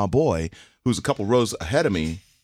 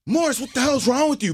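high, shrill-pitched teacher yelling; angry teacher. Retold or imitated. Teacher snapping at a student after mayhem, high. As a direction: play the teacher losing it, much higher. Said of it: "The teacher's like".

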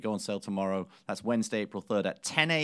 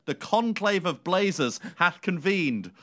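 slow, solemn, grandiose proclamation; grand proclamation; 6 semitones higher, slower than usual. Acted. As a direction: announce it like a herald. Mock solemn announcement.

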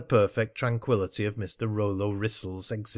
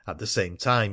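ordinary delivery

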